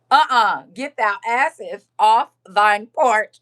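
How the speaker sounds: slow, mock-biblical command; loud, stately scripture-style scolding; KJV voice. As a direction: order them off in mock King James style. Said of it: "in my K J V voice".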